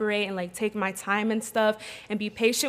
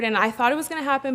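usual speaking voice

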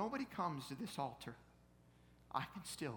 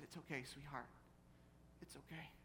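tender comforting; 11 dB quieter than usual. Retold or imitated. Dad holding his sobbing little girl, tender comforting. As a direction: quietly reassure a crying child.

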